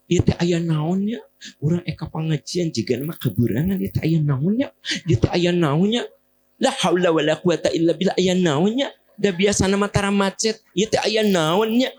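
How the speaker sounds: high-pitched, agitated complaining; acted-out fussing at a holdup; grumbling. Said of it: "Ibu ngomel gitu".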